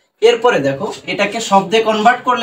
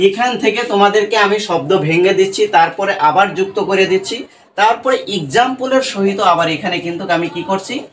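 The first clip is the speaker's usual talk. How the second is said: ordinary talk, nothing marked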